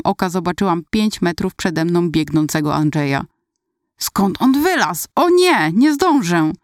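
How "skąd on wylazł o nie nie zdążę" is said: high-pitched, dismayed exclamation; acted panic. A narrator's reading. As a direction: cry out as the race slips away. Narrator's panic during the race. (przerażony, wysoki okrzyk)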